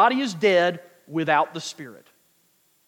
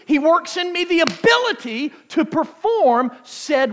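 preaching up, higher and stronger; rising preaching; 8 semitones higher, 4 dB louder than usual. Acted. Preacher exhorting congregation, rising in fervor. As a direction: build the fire of the sermon.

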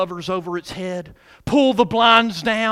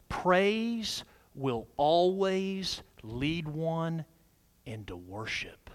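slow, soft, dropping to a whisper; slow down and hush it to a whisper; hushed reverence; 3 semitones lower, 9 dB quieter, much slower than usual. Real, not acted. Preacher revealing the key point on worship, slowing into a hush.

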